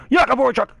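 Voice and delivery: loud, high, aggressive yell of the name; fired-up shout of the player's full name; aggressive name call. Real, not acted. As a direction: bellow his name like a war cry. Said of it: "Get all aggressive with his name".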